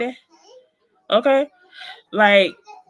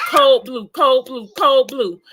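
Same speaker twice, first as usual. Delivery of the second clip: called-out code alert; 3 semitones higher, 6 dB louder, slower than usual. Retold or imitated. Nurse calls code over speaker.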